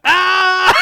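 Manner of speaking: sudden high yelping scream; Link's scream. Retold or imitated. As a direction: yelp like a startled hero fleeing. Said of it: "very funny times where he just screams".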